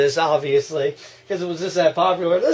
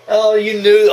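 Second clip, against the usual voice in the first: ordinary talk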